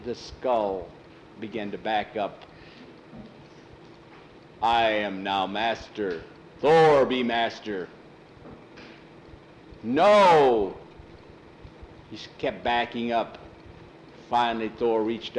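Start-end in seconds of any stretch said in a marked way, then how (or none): 4.6-7.9 slow, deliberate brute voice; lumbering monster. Acted. Thor declaring himself the new master, lumbering. 9.8-10.8 loud, drawn-out no; alarmed cry. Acted. Skull backing away from Thor, alarmed.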